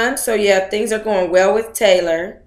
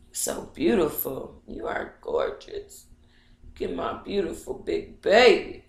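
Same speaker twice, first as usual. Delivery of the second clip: cooing to dog; 2 semitones lower, 9 dB quieter than usual. Real, not acted. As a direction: coo at the dog tenderly.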